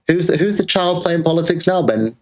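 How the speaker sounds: high, sing-song gloating jab; mocking taunt. Real, not acted. Streamer taunting rival Carl over stolen talking points, high mocking sing-song. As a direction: rub it in, needling, pitched well up.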